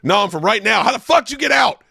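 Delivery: high, loud comic shout; shouted punchline. Retold or imitated. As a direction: yell it back as the punchline. Comedian's shouted punchline retold.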